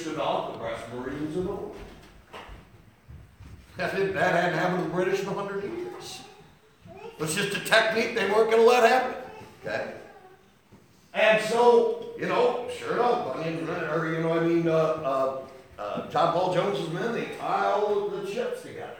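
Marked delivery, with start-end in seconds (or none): 3.8-9.1 loud, higher, driving sermon cry; a full-throated preacher's build; preaching at full force. Acted. 11.1-18.9 a full-throated preacher's build; preaching at full force. Acted.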